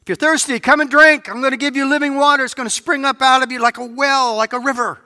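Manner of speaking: high, loud, ringing proclamation; Jesus calling loud to the crowd; outdoor voice. Acted. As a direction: cry it out to the crowd. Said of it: "with his outdoor voice, loud and clear".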